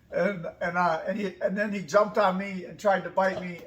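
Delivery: normal talking voice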